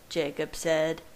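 usual speaking voice

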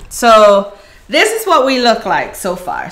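ordinary talk

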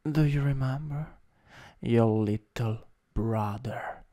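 ordinary talk, nothing marked

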